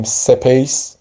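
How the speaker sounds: "سپیس" as se-peys, a vowel put between s and p; wrong extra vowel. Shown as a demonstration. Teacher shows learners a wrong reading, vowel after the s. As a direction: break the cluster in "سپیس": say se-PEYS. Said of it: "درست نیست بگیم".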